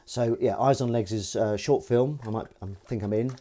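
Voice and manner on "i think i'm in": normal talking voice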